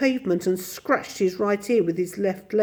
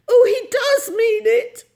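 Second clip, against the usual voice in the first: high, loud distressed wail; hammed-up tearful cry; 7 dB louder than usual. A narrator's reading. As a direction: wail in dismay. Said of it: "cried Miss Lark".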